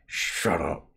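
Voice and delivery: talking around a mouthful of food; words muffled as if through a full mouth. A narrator's reading. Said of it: "Frankie's voice was muffled".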